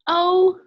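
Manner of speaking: "O" said loud and high as a long oh; long O vowel. Shown as a demonstration. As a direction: announce "O", long oh. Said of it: "our um".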